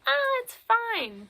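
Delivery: high, slow, airy brush-off; lazy, high-pitched "it's fine"; dismissive mock voice. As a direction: wave the worry away in a silly high voice. Trojans dismiss the warning.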